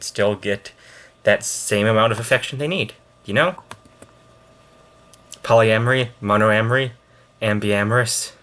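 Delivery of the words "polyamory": ordinary talk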